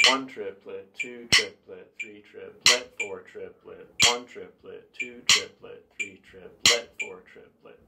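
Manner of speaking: rhythmic triplet count, syllables evenly spaced; counting accented triplets. Shown as a demonstration. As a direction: count steadily along with the stick clicks. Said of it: "It's gonna sound like this".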